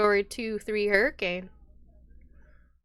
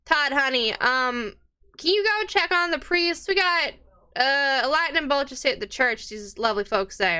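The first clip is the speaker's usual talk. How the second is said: higher, lightly voiced barmaid; a worried tavern keeper's voice, pitched up; 6 semitones higher than usual. Barmaid warns patrons about the priest, lightly higher.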